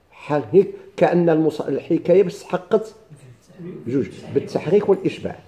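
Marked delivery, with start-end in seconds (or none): none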